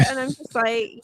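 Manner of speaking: ordinary talk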